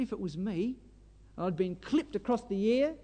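ordinary delivery

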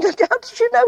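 very high, amazed question; astonished. Acted. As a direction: voice leaps up in acted amazement. Moses amazed that Jethro guessed.

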